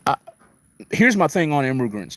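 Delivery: ordinary talk, nothing marked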